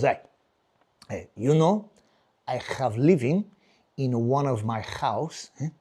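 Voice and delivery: put-on halting Spanish-accented English; Spanish father's accent. Spanish father telling son about his houses, halting Spanish accent. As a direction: imitate a Spanish dad, pausing between phrases. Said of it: "he goes like this the other day".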